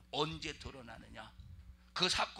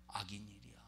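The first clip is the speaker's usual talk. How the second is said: whisper; 7 semitones lower, 11 dB quieter than usual. Real, not acted. Rhetorical answer dropped to whisper.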